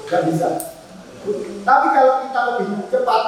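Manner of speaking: usual speaking voice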